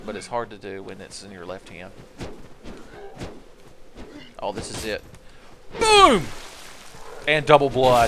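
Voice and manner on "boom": very high, loud exclamation; triumphant shout. Real, not acted. Player landing a hit, triumphant shout. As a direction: burst out as the blow lands. Cheering a landed attack.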